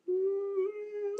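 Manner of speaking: long high "mm" of indecision; drawn-out, high-pitched deliberating hum; pondering hum. Retold or imitated. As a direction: hum high while weighing it up. Acts out pondering the block button.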